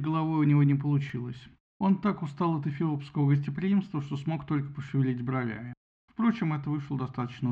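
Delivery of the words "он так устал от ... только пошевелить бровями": ordinary delivery, nothing marked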